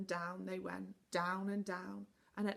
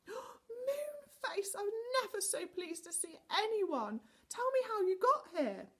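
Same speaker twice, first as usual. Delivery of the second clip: much higher, delighted cry; relieved joy; 14 semitones higher, 4 dB louder, slower than usual. A narrator's reading. Relieved Connie greets Moonface, high and delighted. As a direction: gush with relief.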